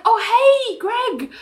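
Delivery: very high, hammed-up cheery surprise; mock fake delight. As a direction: gush a hammed-up, too-bright welcome. [name] faking delight at Greg.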